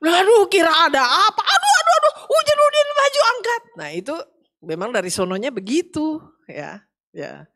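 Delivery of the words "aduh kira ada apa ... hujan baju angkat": much higher and louder panicked cry; mother's alarmed shout. Retold or imitated. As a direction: cry out in alarm about the washing. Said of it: "mama saya almarhumah".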